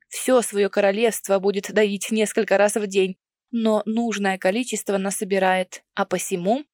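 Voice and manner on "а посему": ordinary delivery, nothing marked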